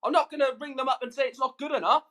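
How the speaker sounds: ordinary talk, nothing marked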